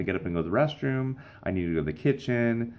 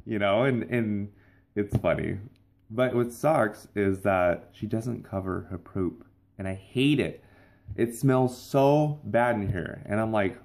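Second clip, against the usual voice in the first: ordinary talk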